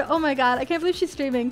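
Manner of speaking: usual speaking voice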